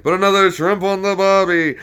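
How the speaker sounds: loud, high hammed-up Australian accent; mock Australian catchphrase. American spoofing shrimp on the barbie, hammed-up Australian. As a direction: call it out like a cartoon Aussie. Acts out stereotyped Aussie catchphrase.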